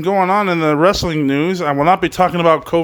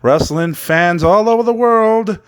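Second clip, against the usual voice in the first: mock ring announcer roll call; hammed-up show-host welcome; announcer intro. Wrestling show host opening.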